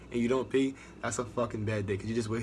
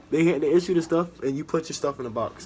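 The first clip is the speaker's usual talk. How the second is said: louder; 6 semitones higher, 8 dB louder than usual. Real, not acted. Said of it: "raised voice".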